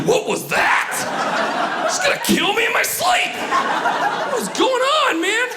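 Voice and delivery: high shouted panic; panicked self. Retold or imitated. Comic panicking at his own thought. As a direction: freak out about a noise. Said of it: "I'm like".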